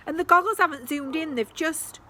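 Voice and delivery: high, loud fretful protest; worried complaint. Acted. Max to Sasha, high loud fretting. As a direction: fret at the broken goggles. Max upset goggles failed.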